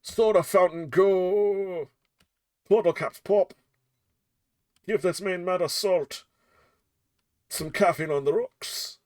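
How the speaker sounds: high, loud wizard incantation; grand spellcasting. Acted. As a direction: proclaim the spell like a showy wizard. Casting a soda spell.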